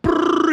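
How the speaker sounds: high loud rapid brrr noise; excited brrr. Retold or imitated. Rapid idea blurting noise.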